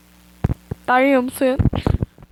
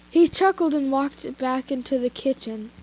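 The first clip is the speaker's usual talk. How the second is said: ordinary delivery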